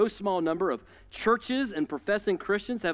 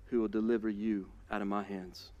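low, soft menacing taunt; a lightly voiced tyrant's dare; menacing king; 9 semitones lower, 6 dB quieter than usual. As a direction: taunt them low and cold. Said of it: "he goes next level".

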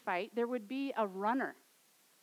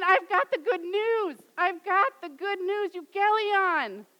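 higher and louder, exultant; breathless, overjoyed announcing; joyful proclamation; 6 semitones higher, 7 dB louder than usual. Retold or imitated. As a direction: shout the good news to the whole town. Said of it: "she's running back and she's saying".

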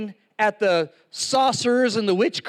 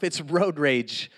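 ordinary talk

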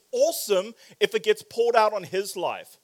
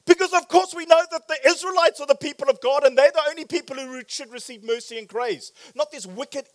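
high, loud, rushed sarcasm; mock self-righteousness; 6 semitones higher, 7 dB louder, faster than usual. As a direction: rattle it off as if utterly obvious. Mocks Jonah's self-righteous reasoning.

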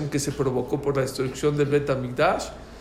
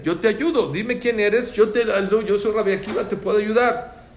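raised, louder, earnest appeal; urgent insistence; 7 semitones higher, 5 dB louder than usual. Retold or imitated. Rabbi begging the burdened man to accept his help, urgent. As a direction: press the offer forcefully, pitched up.

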